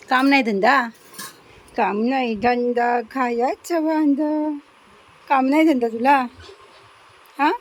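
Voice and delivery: loud mock-scolding of the little one; loud teasing of the pet. Real, not acted. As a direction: tease the pet loudly, half scolding.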